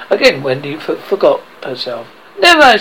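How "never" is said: high, loud refusal; defiant cry. A narrator's reading. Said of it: "she cried".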